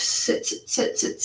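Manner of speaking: soft unvoiced counting; whispered counting. Real, not acted. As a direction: whisper the count under your breath. Counting the sits softly.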